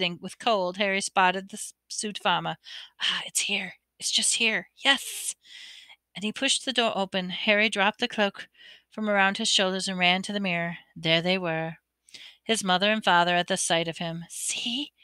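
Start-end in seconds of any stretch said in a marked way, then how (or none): 3.0-5.3 whisper. A narrator's reading. Finding the door in the dark. 14.3-14.9 soft, much softer whisper. A narrator's reading. Harry showing Ron the mirror, hushed whisper. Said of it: "Harry whispered".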